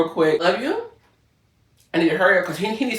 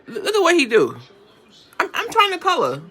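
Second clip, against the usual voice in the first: mock exasperation; 13 semitones higher than usual. Real, not acted. Exasperated at pet.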